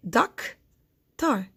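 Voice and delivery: "doctor" as "doc ... tor", long gap between; doctor, one syllable at a time; split into syllables. Shown as a demonstration. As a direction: break doctor slowly in two. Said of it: "to find the syllables".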